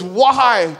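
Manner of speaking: high, loud wail of a question; loud, pleading outburst; exasperated protest. Retold or imitated. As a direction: cry out in mock bewilderment. Peter's protest acted out.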